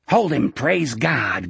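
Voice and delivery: usual speaking voice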